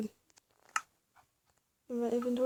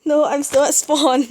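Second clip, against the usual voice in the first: panicking gamer; 3 semitones higher, 7 dB louder than usual. Real, not acted. Panicking under attack at spawn.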